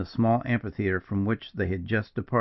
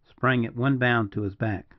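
usual speaking voice

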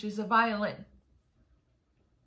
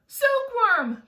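high, loud urgent call; cried out; 13 semitones higher, 8 dB louder than usual. A narrator's reading. James calls the silkworm to the rescue, crying out. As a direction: call out for help at once. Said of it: "cried James".

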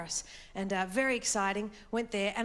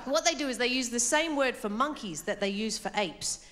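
ordinary talk, nothing marked

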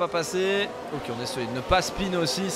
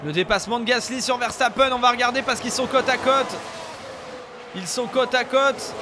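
motorsport commentator; 4 semitones higher than usual. Acted. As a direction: build the tension of a side-by-side battle. Calling an overtake live. (commentateur de course en direct, tendu)

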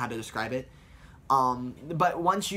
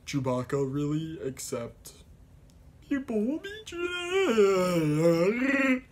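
Chewbacca; 6 semitones higher, much slower than usual. Retold or imitated. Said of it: "Sven is just like".

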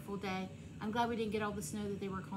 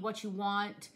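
ordinary talk

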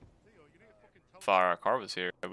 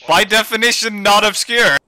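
insistent; 9 semitones higher, 6 dB louder than usual. Real, not acted. Insisting in an argument.

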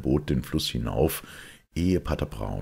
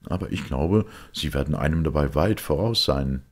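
Smith; 4 dB louder than usual. Acted. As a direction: press the priest with a keen question. Smith answers.

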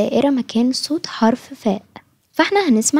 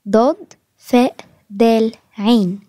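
drawn-out spelling for little learners; slow letter-by-letter spelling; 2 semitones lower, much slower than usual. Shown as a demonstration. Teacher spelling out a word letter by letter for children, slowly. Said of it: "بالشكل ده".

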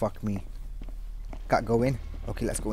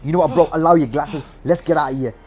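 ordinary talk

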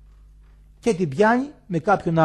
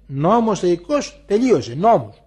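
forceful preaching; 6 semitones higher, 9 dB louder than usual. Acted. Elder declares God's law to listeners, loud and emphatic. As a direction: hammer home the divine law.

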